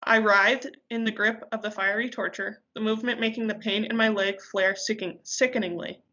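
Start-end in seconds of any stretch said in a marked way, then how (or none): none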